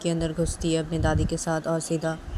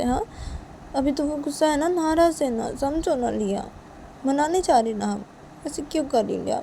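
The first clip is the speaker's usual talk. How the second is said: higher-pitched, gently persuasive; coaxing mother; 7 semitones higher, 4 dB quieter than usual. Acted. Mother promises a later visit to grandparents, coaxing. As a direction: reason sweetly with an upset child. Mother coaxes daughter to understand.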